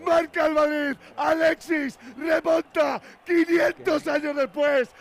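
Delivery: shouted goal call, building excitement; breakneck goal commentary. Real, not acted. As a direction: scream the goal call live.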